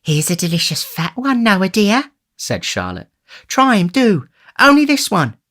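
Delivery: put-on high-pitched doting young woman; high, eager feminine character voice; coaxing sweetheart. A narrator's reading. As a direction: coo the offer to your dear, pitched well up. Coaxing Noah to eat oysters.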